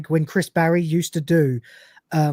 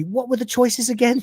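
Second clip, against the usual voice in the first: lightly acted higher-pitched apologetic question; meek query; 8 semitones higher than usual. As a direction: ask timidly, pitched up. Rimmer's meek reply to threat.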